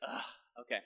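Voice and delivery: a friend grudgingly giving in; reluctant groan. Retold or imitated. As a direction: give in with a groan.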